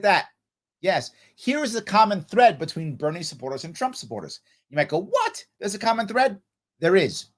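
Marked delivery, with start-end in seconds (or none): 5.0-6.4 very high incredulous squeak on "What?"; mock disbelief. Listener hearing Bernie and Trump fans share something, mock disbelief. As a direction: imitate someone astonished, pitched way up.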